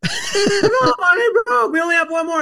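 hammed-up cry of disbelief at the broken board; exasperated props guy.

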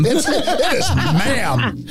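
high, drawn-out, hammed-up outrage; slow, high-pitched pretend scolding; mock indignant correction. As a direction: stretch it out in mock offence.

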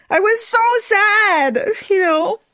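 high, slow, crying voice; tearful. Real, not acted. Speaker remembering her sadness, really tearful. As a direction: stretch it out through real tears. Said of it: "I don't even know why I'm crying".

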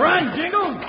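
high, desperate shout to run; frantic yell. Acted. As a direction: yell it as the blast comes. Escaping the exploding powder keg.

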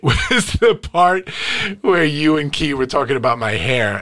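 ordinary talk

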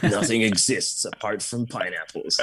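low gravelly trailer narrator; lightly voiced epic trailer announcer; movie trailer voice. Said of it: "massive guy with his gravelly voice going".

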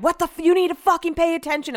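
high-pitched, heated telling-off; imitating a yell at a careless driver; retold angry yell. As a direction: re-enact an outraged scolding. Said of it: "It was like this".

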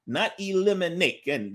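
ordinary delivery

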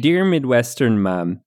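slow Midwestern mom voice; Midwestern mom accent. Acted. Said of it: "my mid-, best Midwestern mom accent".

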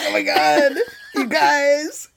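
high, laughing mock excitement; delighted, playfully hammed-up; gleeful imagined reaction. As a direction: squeeze it out through giggles. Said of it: "I'd just be like".

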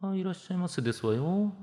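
put-on soft, prim rich young lady; a sheltered ojou-sama clerk greeting; haughty rich girl japanese. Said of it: "お嬢様口調のコンビニ店員". (お嬢様口調の店員)

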